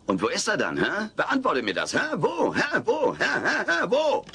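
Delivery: teasing provocation; needling taunt. Acted. Alf badgering with rising hä.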